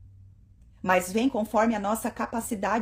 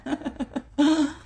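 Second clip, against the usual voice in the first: laughing; 6 semitones higher, 4 dB louder than usual. Real, not acted. Laughs after teasing line.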